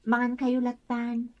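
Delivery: barely voiced from a sick bed; faint, tired voice of someone ill; feeling unwell. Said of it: "Madmadi ti bagik".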